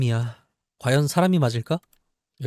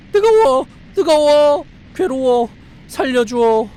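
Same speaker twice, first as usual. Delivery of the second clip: high, loud pretend cries of pain; mock burning screams; 11 semitones higher, 8 dB louder, slower than usual. Voicing a burning doll, high loud mock screams. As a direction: shriek like a doll on fire.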